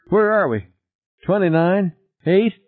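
high, anxious questions; panicky questioning. A narrator's reading. Passenger asks pilot as seconds run out, high and panicky. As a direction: ask urgently in a high voice.

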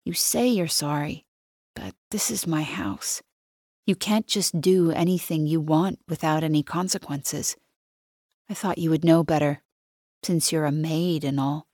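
quiet, cold, lightly voiced boyfriend; soft and eerily calm, a hint of menace; strange, unfamiliar voice. A narrator's reading. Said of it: "His voice still sounds strange".

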